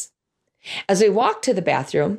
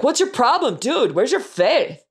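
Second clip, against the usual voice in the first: exasperated; 5 semitones higher than usual. Retold or imitated. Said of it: "He wasn't like".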